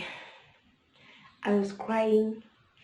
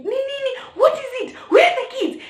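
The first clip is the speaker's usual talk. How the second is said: panicky, much higher, with a laugh breaking in; alarmed high questions; 6 dB louder than usual. Retold or imitated. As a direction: imitate a frightened sister firing questions. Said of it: "So she was like".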